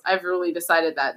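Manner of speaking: ordinary talk, nothing marked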